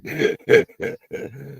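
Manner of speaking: sudden very high squeal of laughter. Real, not acted. Laughs at carbon dated joke.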